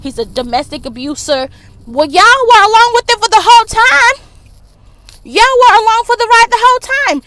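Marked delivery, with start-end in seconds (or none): 1.9-7.2 high, loud and fast angry outburst; real heated yelling. Real, not acted. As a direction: shout it at them, fed up. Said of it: "I don't know why I'm yelling".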